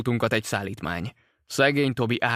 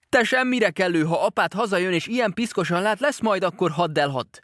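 a lightly voiced mother, higher and scolding; 8 semitones higher, 3 dB louder than usual. A narrator's reading. Mother scolds her dirty little boy, mildly higher. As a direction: tell the boy off like a cross mum.

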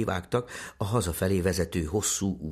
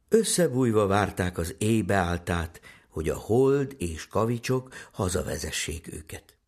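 ordinary delivery

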